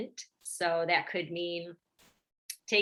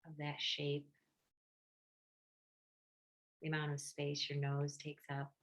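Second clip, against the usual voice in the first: guided meditation; 2 semitones lower, 6 dB quieter than usual. Real, not acted. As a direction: gently guide listeners inward, leaving space between phrases.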